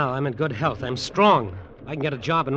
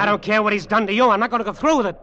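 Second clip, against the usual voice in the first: louder and higher, defiant; angry refusal; 6 semitones higher, 6 dB louder, faster than usual. Acted. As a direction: refuse him outright with a raised voice. Nephew refuses uncle's murder plan.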